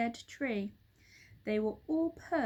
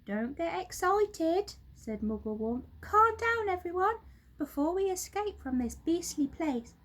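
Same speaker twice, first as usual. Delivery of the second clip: monkey father calming; 9 semitones higher, 3 dB louder than usual. A narrator's reading. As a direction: settle the excited children. Mugglewump calms children.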